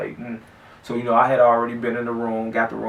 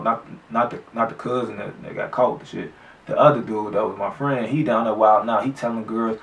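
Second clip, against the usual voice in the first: ordinary talk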